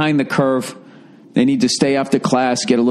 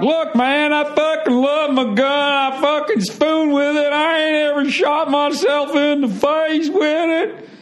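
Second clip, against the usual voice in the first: high, drawling mock gun-lover voice; slower than usual. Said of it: "that defend A R fifteens can be like".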